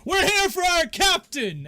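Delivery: high, loud, slow war cry; battle cry. Acted. Bandits jumping the wagon, high shouted battle cry. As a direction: bellow the demand as swords come out.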